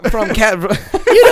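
high, laughing through the words. Real, not acted. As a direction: breaks into laughter mid-sentence. Laughing while talking.

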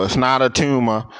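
normal talking voice

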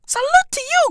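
high mock salute. Mock tribute.

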